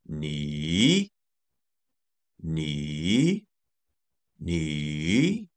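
"ni" dips low then climbs; third tone ni. Shown as a demonstration. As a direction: drop "ni" to the bottom, then swing it up. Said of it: "goes from level two down to level one and back up".

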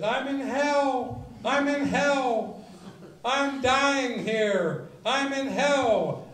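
hammed-up scream of a student in hell down the phone; mock screaming. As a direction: wail like a soul in torment. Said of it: "her screaming at the other end".

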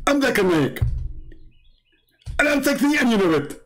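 very high cartoon caller; Zakir Naik. Joking caller introduces himself as Zakir Naik, high cartoon voice. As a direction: announce yourself in a high, put-on voice. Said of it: "okay, Zakir Naik".

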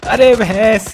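high, loud sudden cry; startled yell. Real, not acted. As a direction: yell out in shock. Sudden alarm in game.

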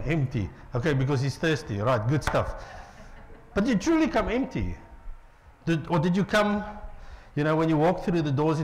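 ordinary talk, nothing marked

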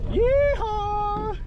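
high cowboy whoop; yeehaw whoop. Real, not acted. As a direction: whoop in triumph. Celebrating the drive.

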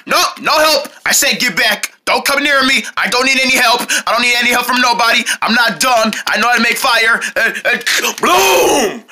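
high, insistent protest; worked-up, defensive outburst; stubborn refusal. Acted. Stubbornly refusing help, straining at fire.

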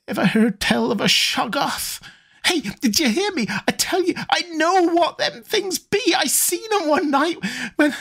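put-on high, quickening frightened old man; rising terror. A narrator's reading. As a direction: panic creeping into the voice. Zadok builds to terror.

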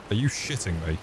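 usual speaking voice

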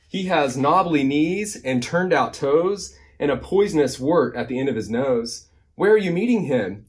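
lively, singsong picture-book reading; animated storytime narration; reading to children. A narrator's reading. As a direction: read the rhyme with big bouncy emphasis. Reads a rhyming picture book.

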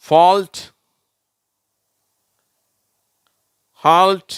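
loud, high-pitched, one word at a time; model word reading. Shown as a demonstration. Teacher reading words for pupils to repeat, loud model reading. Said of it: "நான் வாசிக்கிறேன், நீங்களும் திருப்பி சொல்லுங்க".

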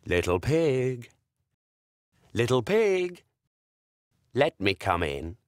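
low, slow growling big bad wolf. Wolf calling to the little pig, low and slow. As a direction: loom at the door as the wolf.

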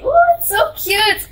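high delighted ooh. Real, not acted. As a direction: squeal at the cute card. Delighted at card cover.

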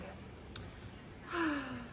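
soft falling sigh of a worn-out engine; tired sigh. A narrator's reading. Old engine sighing, too tired to help. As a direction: let out a weary sigh. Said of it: "the rusty old engine sighed".

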